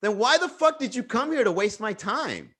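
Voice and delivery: high-pitched indignant demand; retold angry challenge; imitating her anger. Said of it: "she got really fucking mad".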